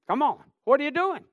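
loud, high demand; forceful challenge. Real, not acted. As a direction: fire the question at the congregation. Same challenge repeated at full voice.

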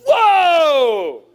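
loud, high, drawn-out astonished whoa; Adam stunned at first sight, big whoa; amazed whoa. Acted. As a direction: gasp it out like a man seeing beauty. Adam sees the woman.

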